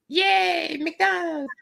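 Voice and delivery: very high falsetto cheer; mock girly squeal of delight; free-spirited girl. Cool chick cheering for fast food, free-spirited girl voice. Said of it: "free of spirit. And then she's like".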